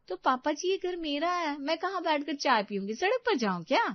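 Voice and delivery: high-pitched indignant retort; high, huffy young wife; defiant daughter-in-law. Acted. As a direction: argue back in a high voice. Story figure: defiant daughter-in-law.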